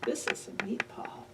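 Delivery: secretive hushed whisper. Acted. Teacher whispering to kids about the meatball.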